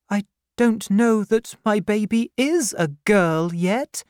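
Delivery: put-on high, pleading young woman's voice; a frightened girl near tears; tearful young widow. The widow explains herself to the aunt, high and pleading.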